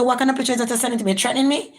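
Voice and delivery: high, fast indignant challenge; indignant confrontation. Retold or imitated. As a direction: demand an answer, offended. Confronting the threat picture.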